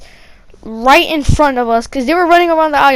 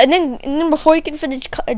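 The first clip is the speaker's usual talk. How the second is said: ordinary delivery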